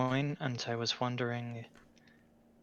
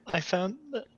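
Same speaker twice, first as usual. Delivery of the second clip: giggly restart; 10 semitones higher than usual. Real, not acted. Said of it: "it's weird to say it now".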